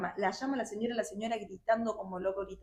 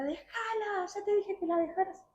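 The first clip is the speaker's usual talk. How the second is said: put-on much higher old lady's cry; old woman yelling; 11 semitones higher than usual. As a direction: pitch up high as the grandmother pleading. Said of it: "la señora solamente gritaba". (la abuela gritando, voz mucho más aguda)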